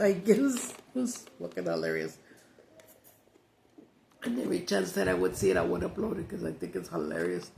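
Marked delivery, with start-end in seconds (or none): none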